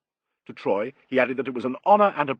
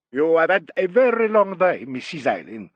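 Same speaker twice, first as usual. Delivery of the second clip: put-on higher, louder Italian-accented character voice; marked Italian accent; 4 semitones higher, 4 dB louder than usual. A narrator's reading. Italian maestro greeting a tired guest, marked Italian accent. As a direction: speak as an expansive Italian gentleman with a marked accent. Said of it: "spoke with a marked Italian accent".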